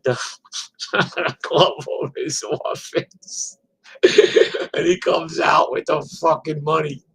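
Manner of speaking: words breaking up with laughter; laughing while talking. Real, not acted. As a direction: crack up mid-story. Laughs while telling the story.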